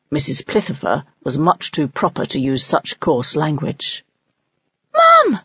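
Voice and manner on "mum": high, loud call to mum; excited call. Acted. Owlet Soren calling to his mother, high and loud. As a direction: gasp it out much higher and louder. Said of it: "Soren gasped".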